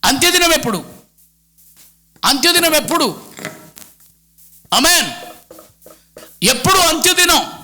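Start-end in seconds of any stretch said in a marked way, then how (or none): none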